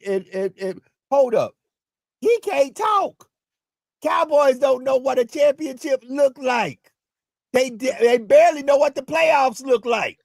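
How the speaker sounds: high-pitched, incredulous protest; worked-up objection. Real, not acted. Sports host heckling a Cowboys fan, worked-up. Heated sports argument, butting in.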